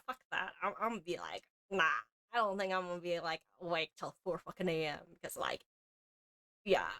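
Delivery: ordinary delivery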